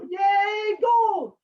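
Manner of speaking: very high loud squeaky cheer; mock cheering crowd. Townspeople cheering at the gold, high loud squeaky cheer. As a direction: shout it like greedy kids. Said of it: "They go".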